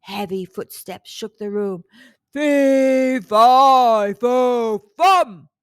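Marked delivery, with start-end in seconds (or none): 2.3-5.5 much louder, booming giant chant; bellowing giant. A narrator's reading. Giant stomps in sniffing for Jack, bellowing. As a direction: boom each word like a giant. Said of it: "bellowed a rumbling voice".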